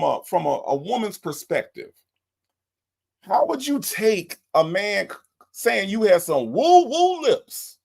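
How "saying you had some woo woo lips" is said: ordinary talk, nothing marked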